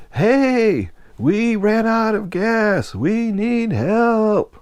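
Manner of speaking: high, slow, drawn-out shout; yelling for help. Retold or imitated. Stranded friend calls up to the deck worker, long high shout. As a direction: stretch every word to carry to the ship. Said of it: "As loud as he could".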